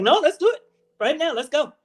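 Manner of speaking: fast, pushy urging; impatient eager voice. Retold or imitated. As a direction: rush them along. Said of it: "You're like".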